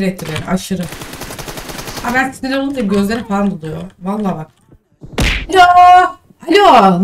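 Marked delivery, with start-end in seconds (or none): 5.5-7.0 loud, sharp Alo called at the rival player; loud annoyed hail; calling out loudly. Real, not acted. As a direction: shout for his attention, annoyed. Shouting at opponent in game.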